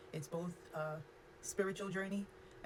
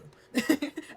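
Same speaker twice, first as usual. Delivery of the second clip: nervous laugh; 10 semitones higher, 9 dB louder than usual. Real, not acted. Nervous laugh before trauma topic.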